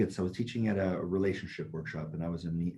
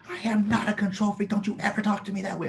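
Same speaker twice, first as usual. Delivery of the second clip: indignant mock shriek; 11 semitones higher than usual. Angry lady denying she's a control freak, imitated as a high outraged shriek. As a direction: fire it back high and fast, offended to the core. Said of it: "she was yelling at me".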